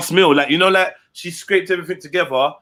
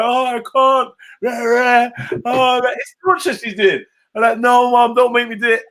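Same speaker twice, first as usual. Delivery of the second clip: pitched-up pleading, hammed-up child sobbing; mock bawling kid; 7 semitones higher, 4 dB louder than usual. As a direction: beg your mum like a sobbing kid. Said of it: "I was just crying".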